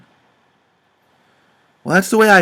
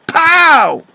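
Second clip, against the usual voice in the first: a sudden sharp shout, much higher and louder; loud yell; 9 semitones higher, 6 dB louder than usual. Retold or imitated. Jim testing if his deaf daughter hears, a sudden loud yell. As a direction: yell it as loud as you can. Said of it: "Just as loud as I could yell".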